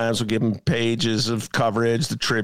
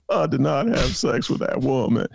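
Clinton impression again; 2 semitones higher than usual. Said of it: "here's my Nick Sposato imitation".

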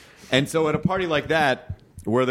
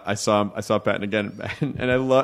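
ordinary delivery

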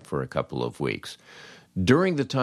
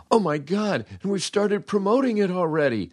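much higher, panicked squeal; a hammed-up high panicked boss; mock panic; 12 semitones higher than usual. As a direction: yelp it like a boss who just realized the blunder. Panicked program director acted out.

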